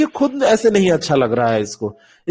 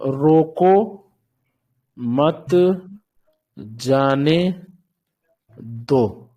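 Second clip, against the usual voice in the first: each word alone with long pauses, "रोको … मत … जाने … दो"; "रोको मत जाने दो" spaced out, no grouping; 4 semitones lower, 3 dB quieter, much slower than usual. Shown as a demonstration. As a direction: dictate "रोको मत जाने दो" one word at a time, slowly. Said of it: "जैसे एक शब्द है".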